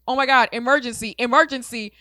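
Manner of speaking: high, loud panicked screaming imitation; shrieked alarm, acted out; retold scream. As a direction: imitate someone screaming for help. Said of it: "she just started screaming".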